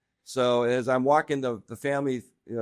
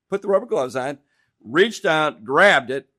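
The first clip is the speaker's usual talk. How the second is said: ordinary delivery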